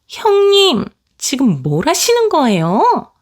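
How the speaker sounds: put-on high, slow, stunned young wife voice; shocked sister-in-law. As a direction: voice the younger sister-in-law demanding what's going on. 동서가 형님에게 따지는 장면. (높고 느리게 따지는 동서 목소리)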